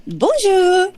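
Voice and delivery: very high, loud sing-song "Bonjour, mon petit chou"; little old French lady. Retold or imitated. Quoting the bed-and-breakfast owner greeting her at the door, very high. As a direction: chirp it like a hundred-year-old French landlady. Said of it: "she'd open the door and she'd say".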